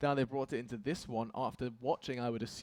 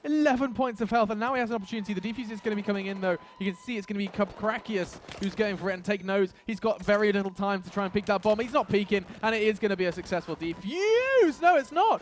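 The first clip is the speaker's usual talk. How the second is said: higher, louder, racing hype; excited play-by-play; 7 semitones higher, 6 dB louder than usual. Real, not acted. Caster calling a tense bomb defuse to viewers, excited. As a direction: ride the clutch moment at full hype.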